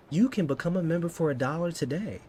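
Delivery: ordinary talk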